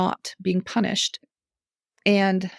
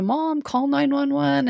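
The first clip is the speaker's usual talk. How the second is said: retold yell; 6 semitones higher, slower than usual. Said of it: "he yells up to me".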